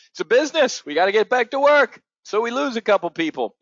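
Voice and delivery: Trump impression. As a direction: sneer it like a callous boss. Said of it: "Trump last night".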